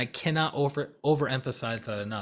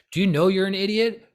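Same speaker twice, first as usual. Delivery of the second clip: loud, high mock berating; worked up; 7 semitones higher, 7 dB louder than usual. To a person who hasn't read the books, worked-up scorn. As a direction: berate them with raised voice. Acted worked-up judging of someone.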